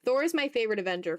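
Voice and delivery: ordinary talk, nothing marked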